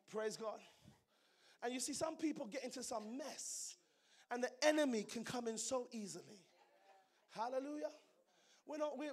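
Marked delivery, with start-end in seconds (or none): none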